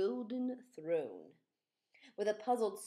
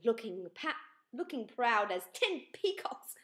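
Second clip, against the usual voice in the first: plain reading voice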